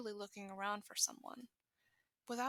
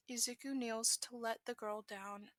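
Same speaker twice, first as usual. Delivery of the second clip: ordinary delivery, nothing marked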